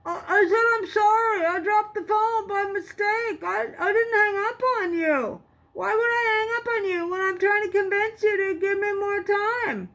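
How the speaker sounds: grovel, stammering and pleading; groveling plea. A narrator's reading. Boy posing as Jason, groveling to the police officer.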